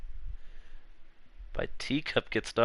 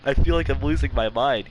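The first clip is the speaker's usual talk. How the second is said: loud panicked; 4 semitones higher, 7 dB louder than usual. Acted. Reading the panicked lines aloud.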